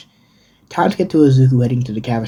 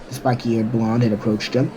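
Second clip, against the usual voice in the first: plain reading voice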